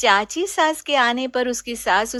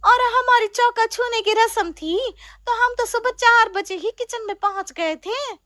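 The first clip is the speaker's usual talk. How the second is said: put-on much higher, proud young bride; young bride's voice; 11 semitones higher, 3 dB louder than usual. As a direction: show off sweetly, pitched high. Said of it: "चाची की बहू कहती है". (बहू अपनी तारीफ़ करती, ऊँची आवाज़ में)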